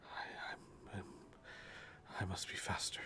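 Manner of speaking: faltering near-whisper; hushed self-reproach. Acted. Companion who failed to protect her, hushed and faltering. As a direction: stammer it under your breath, blaming yourself.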